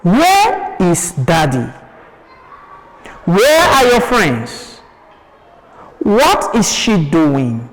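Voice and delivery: questions dropping at "daddy", "friends", "doing"; falling tone. Shown as a demonstration. Teacher gives pupils examples of questions, falling tone. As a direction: bring each question down on its last word, "daddy", "friends", "doing". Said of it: "with a falling tone".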